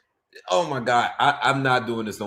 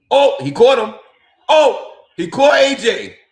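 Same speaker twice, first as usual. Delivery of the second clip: sudden loud burst of excitement; excited shout; 9 semitones higher, 11 dB louder than usual. Real, not acted. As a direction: yell it out as the punch lands. Live reaction to fight punch.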